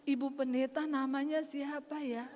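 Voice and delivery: soft, polite question; smiling, sweet. Retold or imitated. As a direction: ask gently through a smile. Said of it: "dia senyum".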